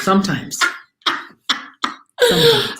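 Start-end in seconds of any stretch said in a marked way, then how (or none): 2.3-2.8 playful high squeak. Pretend. Teasing reply, said twice.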